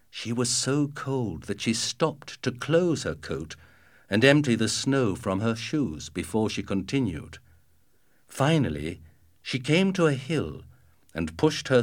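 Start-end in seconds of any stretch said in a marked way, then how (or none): none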